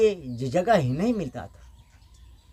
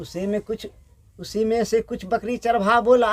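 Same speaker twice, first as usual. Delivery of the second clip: ordinary delivery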